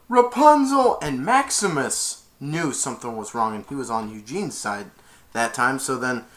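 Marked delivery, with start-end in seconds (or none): 0.1-1.0 loud, high scream of her name; retold frantic shout; desperate call. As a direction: yell for her in panic. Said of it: "He was like".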